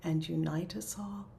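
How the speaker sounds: measured, reverent recital with long pauses; slow prayer. Real, not acted. Leader recites closing prayer for the circle, slow prayer. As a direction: pray slowly, letting each phrase come in. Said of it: "I'll try to say it slowly".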